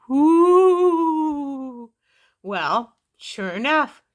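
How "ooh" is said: spooky ooh. Pretend. Spooky sound after title.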